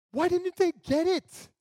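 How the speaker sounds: exasperated outburst. Pretend. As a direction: throw up your hands at the Israelites. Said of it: "Now we might say".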